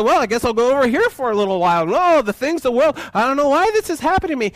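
high, fast, loud pretend fretting; mock drifting ship. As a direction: ham up a rudderless ship rambling aimlessly. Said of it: "She's like".

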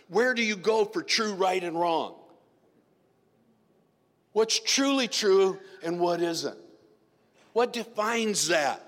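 loud, rising preacher's questions; preaching at full force. Acted. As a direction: hammer each question at the congregation.

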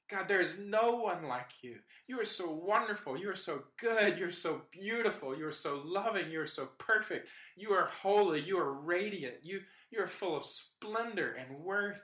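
lifted, fervent prayer of praise; spontaneous prayer. Acted. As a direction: speak straight to God in adoration. Preacher turns to praise God.

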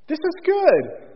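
high-pitched, drawn-out admiring exclamation; God pleased with creation. Acted. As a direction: step back and marvel at it, slowly and high. Voices God admiring his creation.

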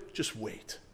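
"Just wait" whispered, low and confiding. Real, not acted. Pastor passing his hard-won habit to the congregation, whispered. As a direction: lean in and whisper it like a secret.

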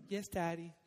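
put-on a small child's soft little voice; toddler's reply. Daughter forgives her father, soft little toddler voice. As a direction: voice a tiny daughter answering yes.

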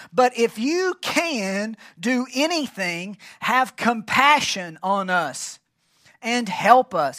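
slow, drawn-out plea; a desperate dad begging, slow; pleading father. Acted. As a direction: plead slowly for help.

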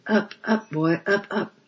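put-on high small voice of a toddler; piping child calling to wake; little child voice. A narrator's reading. Child voiced waking him.

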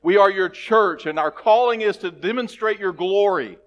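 slow, loud fervent plea to God; crying out in prayer. Pretend. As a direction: cry it out to the Lord. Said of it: "we could simply cry out and say".

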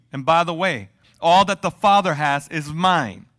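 preacher declaiming scripture; preaching the scripture. Acted. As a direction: proclaim every promise to the congregation. Preaching the passage at force.

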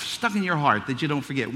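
ordinary talk, nothing marked

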